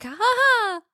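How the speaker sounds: high mock cackle easing into a taunt; hammed-up gleeful villain, high-pitched. Voices inmate ambushing the trainee.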